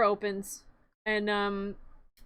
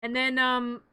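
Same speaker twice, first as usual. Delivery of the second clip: ordinary talk, nothing marked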